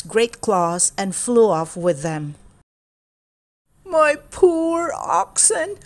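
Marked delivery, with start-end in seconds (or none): 3.8-5.8 high, slow, tearful lament; a grieving farmer with a hint of tears; weeping. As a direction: grieve over the lost oxen, drawing it out. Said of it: "Ahmed wept".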